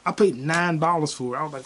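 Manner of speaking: usual speaking voice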